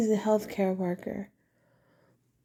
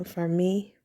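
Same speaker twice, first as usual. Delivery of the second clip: ordinary talk, nothing marked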